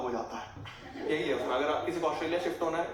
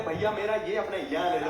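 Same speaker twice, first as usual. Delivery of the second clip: pleading tone; 4 semitones higher than usual. Retold or imitated. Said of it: "ये किस tone में बात कर रहा हूँ?".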